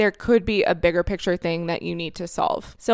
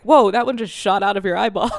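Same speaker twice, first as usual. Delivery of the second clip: husband's line through laughter; amused partner, laughing it out; 5 dB louder than usual. Retold or imitated. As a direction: tease her while laughing. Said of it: "He's like".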